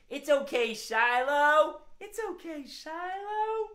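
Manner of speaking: high gentle reassurance; sweet coaxing voice. Shown as a demonstration. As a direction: soothe a shy critter.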